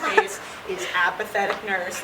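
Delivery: normal talking voice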